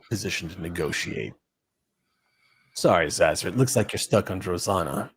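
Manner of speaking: low, unhurried mercenary voice, politely refusing; calm Nausicaan thug. Acted. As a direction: Nausicaan leader turning down the negotiation, low and measured.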